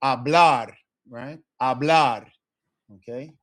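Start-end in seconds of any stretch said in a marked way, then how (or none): 0.0-0.7 "Hablar" with a loud final syllable, a-BLAR; last-syllable stress. Shown as a demonstration. Teacher demonstrating a stress rule, BLAR in "Hablar" punched. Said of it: "stress the last syllable". 1.6-2.3 "Hablar" louder on the ending, a-BLAR; last-syllable stress. Shown as a demonstration. Teacher repeating the example, BLAR in "Hablar" stressed. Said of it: "stress the last syllable".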